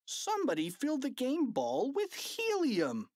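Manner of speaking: high indignant call; fan's shouted complaint. A narrator's reading. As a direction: shout the news from the bleachers. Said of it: "shouted a fan in the bleachers".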